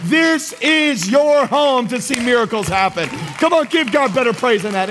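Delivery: high, rousing preacher's exhortation; full-force preaching. Real, not acted.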